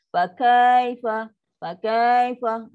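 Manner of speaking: "Fa kaifa" slow, the "Fa" dragged; overlong recitation. Shown as a demonstration. Teacher demos the overlong "Fa kaifa" as wrong. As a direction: drag "Fa" out too long. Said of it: "dah jadi melirit pula".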